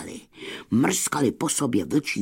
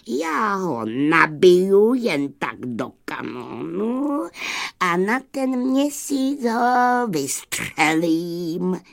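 high put-on gunner voice, slowing down; obliging gunner; 7 semitones higher than usual. A narrator's reading. Gunner explaining the moon shot to wolves, obliging put-on voice. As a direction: draw it out as a helpful cannoneer. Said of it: "ochotně vysvětloval kanonýr".

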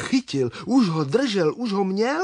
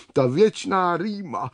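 ordinary delivery

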